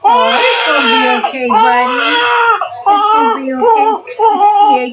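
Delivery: loud, high wailing sob over the hurt doll; hammed-up crying for the fallen doll; mock crying. Teacher fakes sobbing over a hurt baby doll, loud wailing.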